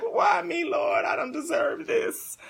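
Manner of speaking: high, crying plea to the Lord; retold crying. Mom retelling her pity party, crying out to God. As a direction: wail it up high like a sob. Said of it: "and I was crying".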